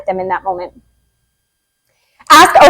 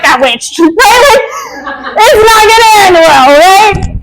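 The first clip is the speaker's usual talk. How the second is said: laughing; 8 semitones higher, slower than usual. Real, not acted.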